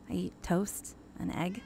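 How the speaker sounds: ordinary delivery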